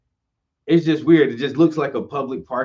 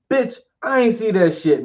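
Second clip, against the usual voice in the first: outraged; 10 semitones higher, 5 dB louder than usual. Real, not acted. Outraged rant at parking ticket.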